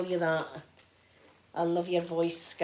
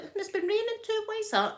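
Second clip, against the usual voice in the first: puzzled; 13 semitones higher, faster than usual. Retold or imitated. Puzzled that prints stayed.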